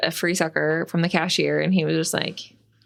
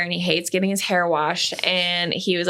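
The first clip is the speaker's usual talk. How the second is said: ordinary talk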